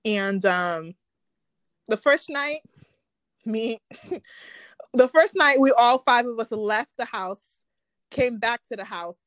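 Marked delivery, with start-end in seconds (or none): none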